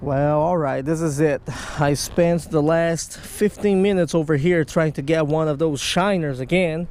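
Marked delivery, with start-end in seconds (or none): none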